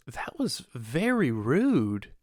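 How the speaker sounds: very slow, swooping mock indignation; offended lady customer. Lady scolds the gun seller, mock offended. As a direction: mimic a scolding customer, stretching each word out.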